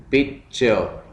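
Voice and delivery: Picture as पिक, pause, चर; split into syllables. Shown as a demonstration. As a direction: break picture into two separate beats. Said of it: "syllables में divide करना है".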